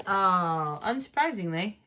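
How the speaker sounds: ordinary talk, nothing marked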